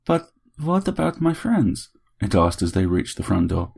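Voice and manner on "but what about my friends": put-on high, slow, accented plea; pleading little robot. A narrator's reading. Bot asks Harry at the front door, pleading.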